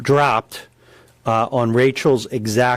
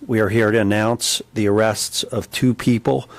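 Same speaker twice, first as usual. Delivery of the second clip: ordinary talk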